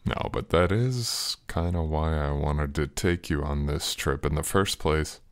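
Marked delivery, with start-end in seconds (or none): none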